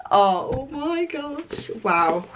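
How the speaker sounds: real amazed swoon; awed delight. Real, not acted. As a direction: stretch it out slowly in wonder. Reacting to silver lettering.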